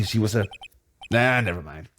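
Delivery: usual speaking voice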